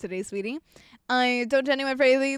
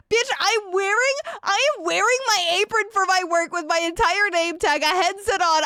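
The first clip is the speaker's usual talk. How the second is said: higher, louder outraged rant; exasperated rant; 6 semitones higher, 4 dB louder than usual. Real, not acted. Streamer venting to chat about a customer, fed up. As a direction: spell out the obvious, fed up.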